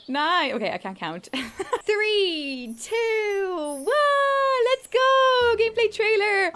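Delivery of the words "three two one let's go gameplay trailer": countdown climbing higher to a squeal; counting down, getting higher and more thrilled each number; excited countdown. Real, not acted. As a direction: build from giggly count to high cheer. Counting down to the trailer.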